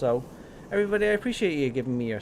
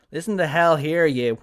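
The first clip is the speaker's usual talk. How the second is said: louder, angry demo where his own accent breaks through; angry, native accent slipping; 2 semitones higher, 7 dB louder than usual. Shown as a demonstration. Streamer showing viewers how his accent slips when angry, louder angry bark. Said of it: "my original accent will come out when I'm trying to portray that I'm very angry".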